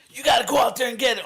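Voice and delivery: high, loud, fast fired-up speaker shout; imitating a motivational yell. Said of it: "he's always yelling".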